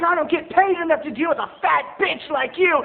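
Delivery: high, loud insult; sassy clerk. Retold or imitated. Clerk insulting mom, high loud sass. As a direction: fire it back with sass. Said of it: "He's like".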